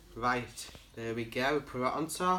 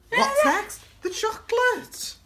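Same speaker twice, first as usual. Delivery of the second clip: high, excited gasp then a slow, drawn-out reveal; gasp, then stretch the word chocolate up high; gasping excitement; 4 dB louder, slower than usual. Real, not acted.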